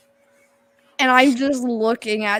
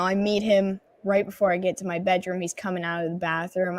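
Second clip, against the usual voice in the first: ordinary delivery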